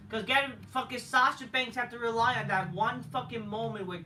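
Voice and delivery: usual speaking voice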